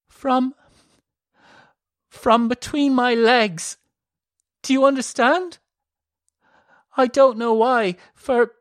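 high, loud halting distress; frightened confession. A narrator's reading. Elisa explaining her bleeding to Malern, frightened. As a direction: squeeze out each word in fear.

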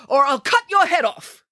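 high, drawn-up royal threat; hammed-up threat from a king; threatening king. King threatens to behead the scientist, threatening.

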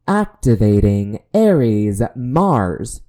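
slow, loud robot announcement; alien robot voice. Shown as a demonstration. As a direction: command it like a machine. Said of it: "if you do the alien robot voice".

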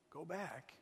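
resigned murmur. Acted. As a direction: let it sink in defeat. Quiet resigned echo.

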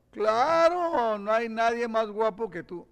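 high, exaggerated mock flattery; gushing sarcastic praise; sarcasm. Shown as a demonstration. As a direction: overdo the agreement so it is clearly fake. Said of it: "Eso es sarcasmo".

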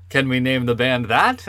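imitating a Swedish speaker telling a story; Swedish accent. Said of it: "This is a Swedish accent".

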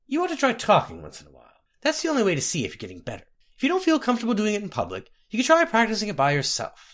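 high, quick, chattering advice; chatty healer. Acted. Healer urging her mute patient to practise talking, chatty.

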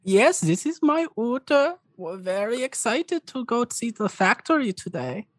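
put-on high-pitched elderly dragonborn with a European accent; old dragonborn man. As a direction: greet them as a high-voiced old uncle. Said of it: "and the old man just goes".

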